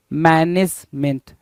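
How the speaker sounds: slow MAN-ej-MENT; correct management. Shown as a demonstration. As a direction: say मैनेजमेंट slowly, syllable by syllable, ending "ment". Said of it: "मैनेजमेंट होता है". (मैनेजमेंट धीरे, "मेंट" साफ़ बोलें)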